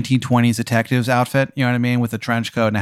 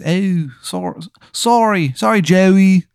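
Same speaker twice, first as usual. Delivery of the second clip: high, loud, hammed-up Maryland accent; a bad Maryland accent pitched way up; overdone Maryland accent, loud and high; terrible Maryland accent; 8 semitones higher, 3 dB louder, slower than usual. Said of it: "His accent is so bad".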